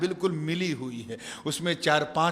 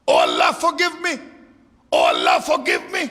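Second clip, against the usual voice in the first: crying out to God; 8 semitones higher, 8 dB louder, slower than usual. Acted. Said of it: "he started to cry".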